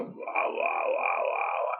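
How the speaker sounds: creaky croaking wahs; spooky glottal fry croaks; scary fry sounds. Shown as a demonstration. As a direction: growl out eerie fry noises. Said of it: "can kinda sound scary if you go".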